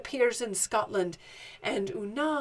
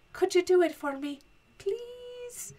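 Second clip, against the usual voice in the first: sweet pleading; 7 semitones higher than usual. Acted. Wife asking visiting giant to turn the house, pleading. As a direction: coax with a long, tiny voice.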